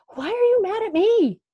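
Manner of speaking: voiced Kate protesting, high and strained; whisper yelling. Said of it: "She's still whisper yelling".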